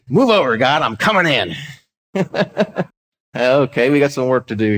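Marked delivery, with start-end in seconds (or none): 0.1-1.6 breezy woman barging into heaven; cocky lady's answer. Retold or imitated. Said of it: "a lady who said". 2.1-2.8 a quick high amused laugh; short laugh. Real, not acted. Laughing at the lady's answer.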